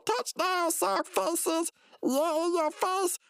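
very high mock victory cry; touchdown gloating. Slamming down the laundry after a catch, high mock gloating. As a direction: crow it in a put-on high cartoon voice. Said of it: "slam it down and say".

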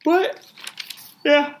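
yawning while talking; words stretched by a yawn. Real, not acted. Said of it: "I gotta stop yawning".

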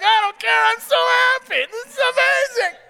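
very high, loud, laughing-crying cry of joy; ecstatic shout. Retold or imitated. Shouting with joy to the staring neighbor at a light. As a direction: let the joy burst out, half crying.

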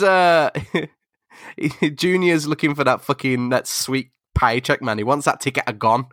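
normal talking voice

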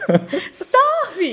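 high, loud, drawn-out disbelief; incredulous surprise. Real, not acted. Wife questioning husband's claimed surfing hobby, loudly incredulous. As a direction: stretch it out high and loud in amazement.

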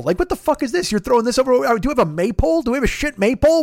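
usual speaking voice

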